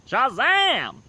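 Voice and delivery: high, loud cry of Shazam; magic word shout. Retold or imitated. Billy's magic word, shouted high and loud. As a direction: call out the magic word to transform.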